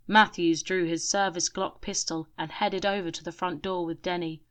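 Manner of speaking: usual speaking voice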